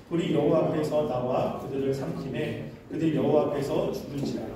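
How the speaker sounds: low, even Bible-reading voice; scripture reading. Real, not acted. Preacher leading a reading of Leviticus, low recitation. Reads the scripture passage aloud.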